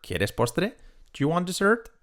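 ordinary talk, nothing marked

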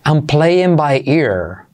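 I'm playing by ear said slowly and clearly; slow model phrase. Shown as a demonstration. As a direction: spell the phrase out slowly for learners. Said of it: "Número tres". (frase modelo lenta y clara)

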